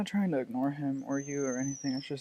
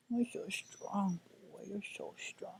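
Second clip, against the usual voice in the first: higher, slow, soft encouragement; tender praise; 5 semitones higher, 3 dB quieter, slower than usual. Real, not acted. Owner encouraging her hurting dog, soft slow praise. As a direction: reassure him gently and slowly.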